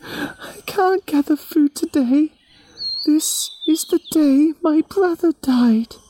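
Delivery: words breaking into tears; Yami weeping. Acted. Yami refusing the creators through tears. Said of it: "Yami shook her head and said".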